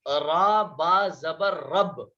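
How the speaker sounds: slow Arabic spelling: را با زبر, then رب; spelling out a word. Shown as a demonstration. Teacher spells رب for students, very slow and deliberate. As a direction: sound out را با زبر رب very slowly, letter by letter. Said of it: "جیسے".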